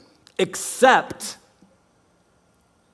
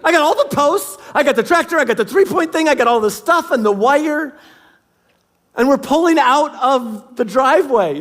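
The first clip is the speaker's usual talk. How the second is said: excited; 7 semitones higher than usual. Real, not acted. Excited retelling of fence prep.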